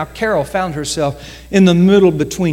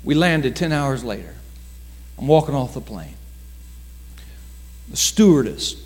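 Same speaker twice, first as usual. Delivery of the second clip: normal talking voice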